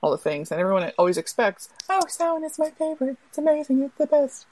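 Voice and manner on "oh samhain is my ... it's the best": high-pitched mock gushing; gushing enthusiasm. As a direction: imitate an overexcited fan, pitched way up. Mimics everyone's predictable favorite holiday gush.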